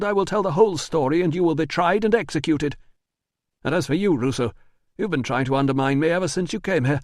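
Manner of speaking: high, fast, defensive denial; nervous manager. Acted. Manager denies the letter to Russo, high and rushed. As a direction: talk fast to dodge the blame. Priscus threatens.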